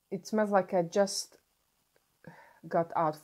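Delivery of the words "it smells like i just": ordinary talk, nothing marked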